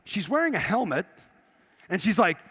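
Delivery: ordinary delivery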